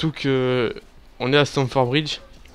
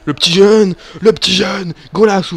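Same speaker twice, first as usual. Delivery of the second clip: excited shout; 5 semitones higher, 8 dB louder than usual. Real, not acted. Cheering young player's run.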